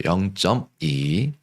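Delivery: slow, drawn-out model reading; reading demonstration. Shown as a demonstration. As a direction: read it out slowly for pupils to follow. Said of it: "영점이는 어떻게 읽냐면요, 이렇게 읽어요".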